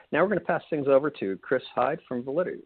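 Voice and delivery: ordinary talk, nothing marked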